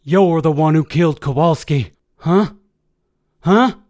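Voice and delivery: enraged soldier yelling, climbing in pitch; screaming accusation. A narrator's reading. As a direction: press him harder with each rising "huh". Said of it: "Colton started screaming louder".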